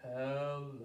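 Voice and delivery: deep, soft, drawn-out "Hello"; as low as possible. Shown as a demonstration. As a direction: drop "Hello" to the bottom of the voice. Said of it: "Let's say hello as low as we can".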